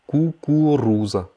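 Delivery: кукуруза said slowly and clearly; slow teaching repeat. Shown as a demonstration. Teacher slowing кукуруза down for learners. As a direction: draw out кукуруза. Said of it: "slow repeat of vocabulary".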